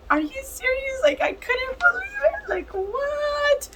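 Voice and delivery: very high, tearful squeal; overwhelmed disbelief. Retold or imitated. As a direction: squeal it in stunned disbelief, close to crying. Reliving stunned tears at the news.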